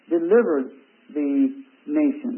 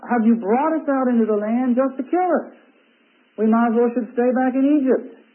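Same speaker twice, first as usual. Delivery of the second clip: higher-pitched mock complaint; lightly acted grumbling in a raised pitch; complaining; 6 semitones higher than usual. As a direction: voice the people's grievance, pitched up. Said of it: "The people start complaining and say".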